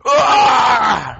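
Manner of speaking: loud, high game scream. Real, not acted. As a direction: scream out in sudden alarm.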